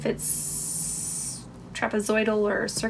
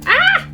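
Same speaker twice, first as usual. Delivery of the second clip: high loud shriek; startled shriek; 10 dB louder than usual. Real, not acted. Crafter shrieking as the balloon tips, high and loud. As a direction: let out a sudden shriek.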